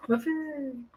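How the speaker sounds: half-hearted high-pitched echo; exclamation, imitated. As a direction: echo the exclamation without raising volume. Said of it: "So that's how he said it".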